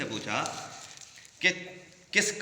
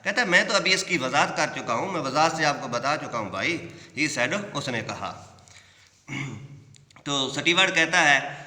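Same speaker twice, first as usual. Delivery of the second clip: ordinary delivery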